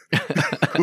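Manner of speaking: a bright burst of laughter; real laugh. Real, not acted. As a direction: laugh at the irony. Amused by his own story.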